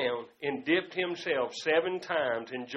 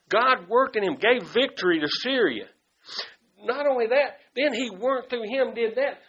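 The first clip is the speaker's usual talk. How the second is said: fervent preaching; 2 semitones higher, 9 dB louder than usual. Acted. Loud preaching.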